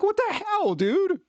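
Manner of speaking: high, stunned mock disbelief; incredulous what the hell. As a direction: throw it up high, baffled. Mock outrage at an ad.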